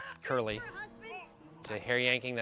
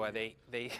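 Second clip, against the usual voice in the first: ordinary talk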